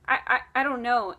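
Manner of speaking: acted flustered stutter on "I"; imitated stuttering. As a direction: stammer over the first word. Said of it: "I'm, like, stuttering".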